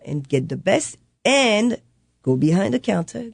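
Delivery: ordinary talk, nothing marked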